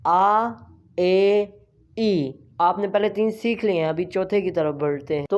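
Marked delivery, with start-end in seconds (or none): 0.1-2.4 आ, ए, ई held and separated; Spanish vowel drill. Shown as a demonstration. Teacher reviews three vowels, separated आ, ए, ई. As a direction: sound out "aah", "ay", "ee" for आ, ए, ई. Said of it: "तीन vowels कैसे pronounce करने".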